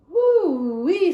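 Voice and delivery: loud high whoop of wonder; amazed whoop. Acted. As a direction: let it fly up high and loud in delight. Gloria's amazed exclamation.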